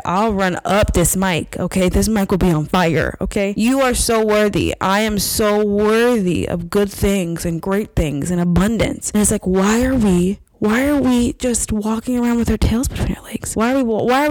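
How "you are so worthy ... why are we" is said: ordinary talk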